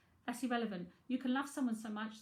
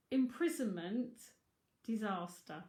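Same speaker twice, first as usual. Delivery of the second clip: ordinary delivery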